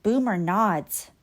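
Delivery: ordinary delivery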